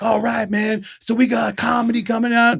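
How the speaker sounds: high-pitched mock hype intro; stage introducer. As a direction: imitate an MC warming up a crowd. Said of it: "and you're like".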